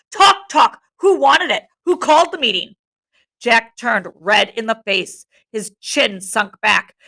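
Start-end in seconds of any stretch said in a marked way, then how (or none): 0.1-2.8 high, loud challenge; exasperated shout. A narrator's reading. Ralph challenging the meeting, shouting. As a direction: shout it at the whole group. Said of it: "shouted Ralph".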